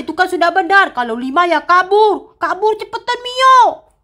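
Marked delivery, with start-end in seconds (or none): 2.4-3.9 rising panicked call; urgent shout. Acted. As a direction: scream her name to hurry. Fleeing the angry giant.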